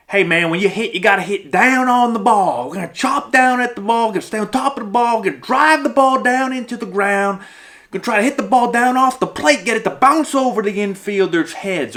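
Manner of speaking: loud, high Southern baseball coach; put-on Southern coach voice, high and forceful; Southern accent. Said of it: "I'm giving him a Southern accent".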